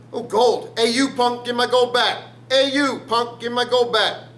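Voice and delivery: high, loud hammed-up yell; mock shouting. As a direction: call after a thief in pretend outrage. Calls after a gold thief.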